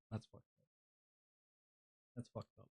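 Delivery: trailing off into near silence; fading mutter. Real, not acted. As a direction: let the repeat die away. Repeats complaint to himself.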